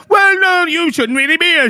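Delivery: mocking imitation. Angry man mimicking his opponent scolding a child, mocking. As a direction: parody a feeble telling-off. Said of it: "and he's like, there".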